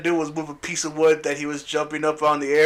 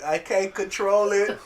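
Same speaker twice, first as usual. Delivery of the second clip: slow mock whine; hammed-up whine of I can't control it; whiny Jean; 3 semitones higher, slower than usual. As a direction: drag it out helplessly. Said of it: "She's like".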